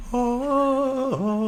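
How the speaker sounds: high, held choral "oh"; mock angelic choir. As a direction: sing out a long heavenly note. Heavenly sound as unicorn accepts.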